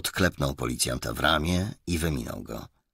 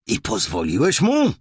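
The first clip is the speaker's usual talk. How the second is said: louder, higher indignant question; raised voice; 7 semitones higher, 8 dB louder than usual. A narrator's reading. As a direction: challenge the subordinate with a raised voice. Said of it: "podniósł głos". (podniesiony, pełen wyrzutu głos)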